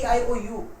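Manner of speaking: ordinary talk, nothing marked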